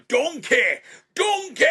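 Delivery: loud shouted ogre calls, pitched high; Shrek shouting. Retold or imitated. Actor as Shrek yelling for his friend. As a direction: bellow for Donkey in a Scottish burr. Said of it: "doing the scenes".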